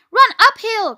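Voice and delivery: high, loud urgent cry; cried out. A narrator's reading. Sheep directing the fleeing pig, cried out. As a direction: call it out sharply high. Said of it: "cried the sheep".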